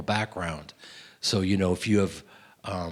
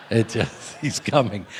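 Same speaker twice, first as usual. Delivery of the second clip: chuckling through the repeated line; laughing. Real, not acted.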